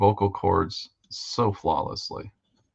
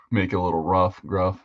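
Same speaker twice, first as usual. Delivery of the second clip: ordinary delivery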